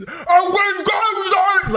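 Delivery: high, loud, slow shout; mock panicked shout. Pretending to apologize to the bear, high loud shout.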